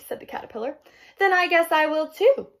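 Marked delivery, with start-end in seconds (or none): 1.2-2.5 high, loud, cheerful pollywog; happy. A narrator's reading. Pollywog decides to join the caterpillar, happy. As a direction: chime in happily, voice lifted. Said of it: "the pollywog said happily".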